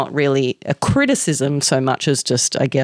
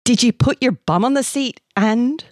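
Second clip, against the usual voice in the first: higher, fervent Scottish questioning; Scottish accent; 9 semitones higher than usual. A narrator's reading. Kilted man questioning Amanda, urgent Scottish accent. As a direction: interrogate her with a Scottish accent. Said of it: "he said fervently in a thick Scottish accent".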